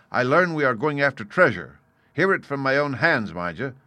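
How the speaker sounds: lightly voiced captain laying down his objections; blunt captain, airing grievances. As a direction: state it firmly, punching key words.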